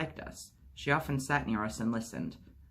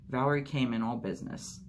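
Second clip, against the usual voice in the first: plain reading voice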